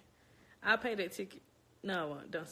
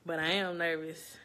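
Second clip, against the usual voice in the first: ordinary talk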